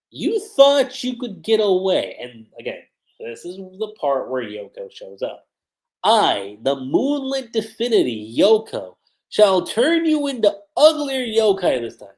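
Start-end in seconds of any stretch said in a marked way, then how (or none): 0.1-2.2 slow, louder, raised-pitch taunt; villain's taunt. Acted. Yoko confronting the group, taunting. 6.0-12.1 louder, theatrical self-announcement; villainous proclamation. Acted. Yoko threatening to transform them, grandly menacing.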